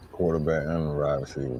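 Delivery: repeating the line through a laugh; cracking up at the joke. Real, not acted. Friend repeats the joke while laughing.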